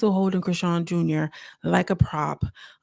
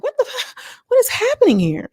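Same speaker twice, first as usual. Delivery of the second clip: very high-pitched mock disbelief; acted bewilderment; 3 dB louder than usual. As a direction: exaggerate your shock at a strange scene. Acts out bewildered reaction to prayer.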